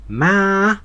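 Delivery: MA held high and level. Shown as a demonstration. Learner recalling Chinese tones, high tone on MA. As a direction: say MA high and sustained, in the high Mandarin tone. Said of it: "the high tone".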